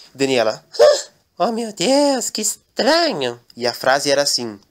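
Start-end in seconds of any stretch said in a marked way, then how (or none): 1.4-3.4 high, slow wondering voice; puzzled maid. Acted. Daniela reads the inscription.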